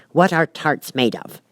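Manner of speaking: ordinary delivery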